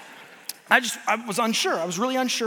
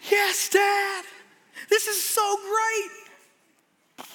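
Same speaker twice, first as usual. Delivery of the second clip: put-on much higher, a child's squealing reply; excited daughter; 10 semitones higher, much slower than usual. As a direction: squeal it like an eager little girl. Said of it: "daughter answering before the drop".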